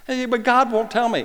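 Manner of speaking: whiny student. Retold or imitated. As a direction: mimic a whining kid pleading. Mocking a complaining student.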